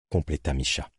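ordinary delivery, nothing marked

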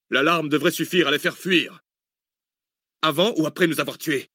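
high-pitched tense exchange; urgent alarm. A narrator's reading. Two men argue as killers approach, urgent alarm. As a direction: raise the pitch with the danger, without shouting. Panicked exchange during the break-in.

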